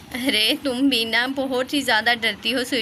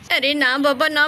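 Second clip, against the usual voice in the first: usual speaking voice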